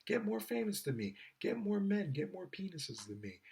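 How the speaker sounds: mock a girl inviting rivals in; sing-song high girly mockery. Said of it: "tell other girls".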